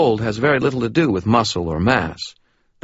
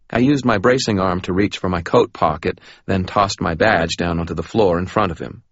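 plain reading voice

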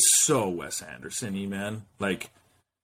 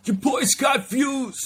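much higher, slow swaggering hype-man voice; hype persona voice; slower than usual. Acted. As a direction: announce yourself like a strutting alter ego.

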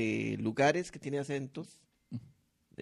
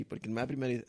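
ordinary talk, nothing marked

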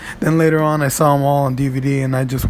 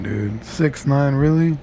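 slow disbelief; slower than usual. Real, not acted. Disbelief at rapper's name.

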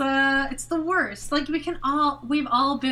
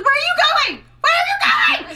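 mock shout; 9 dB louder than usual. Mimicking Snooki's shouts.

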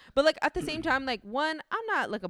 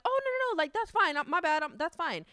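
much higher, sweet apologetic voice; polite apology; 8 semitones higher than usual. Pretend. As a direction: act out an overly nice girl. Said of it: "would have been like".

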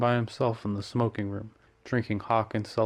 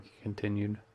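usual speaking voice

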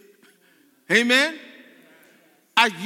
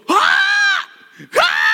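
loud, high-pitched shriek; flipping-out scream; 11 dB louder than usual. Acted. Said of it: "Sister Miggy flips out. She goes".

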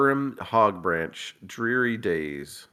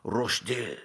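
softer, put-on French accent; hammed-up French pronunciation; mock French accent; 5 dB quieter than usual. Shown as a demonstration. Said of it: "in the infamous podcast French accent".

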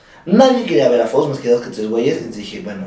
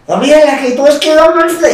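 annoyed roommate; 13 semitones higher than usual. Retold or imitated. Acts roommate woken up annoyed.

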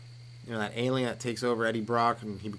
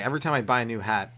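normal talking voice

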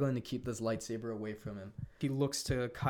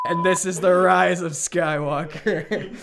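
slow, loud, laughing through it; can barely get it out for laughing; amused at the cheesy scene; 6 semitones higher, 13 dB louder, slower than usual. Real, not acted.

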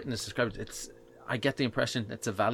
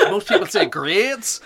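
laughing, higher and louder Southern drawl; mock Southern accent; 9 semitones higher, 9 dB louder, slower than usual. Imitating co-host who denies her accent, mock Southern drawl. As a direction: tease with an exaggerated Southern accent. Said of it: "she said the word grits five times".

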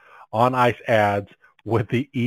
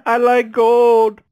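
high, slow, hammed-up Dutch voice; Dutch accent; 11 semitones higher, 5 dB louder, much slower than usual. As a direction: ham up a silly Dutch accent. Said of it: "Right, like".

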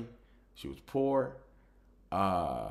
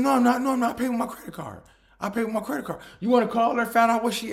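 high, loud mock protest; hammed-up ex shrilly denying; indignant denial; 13 semitones higher, 8 dB louder, faster than usual. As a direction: insist you're not lying, pitched up. Ex protesting her innocence.

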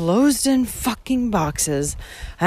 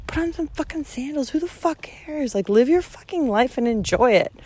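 retold rant; 8 semitones higher than usual. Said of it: "I'm like".

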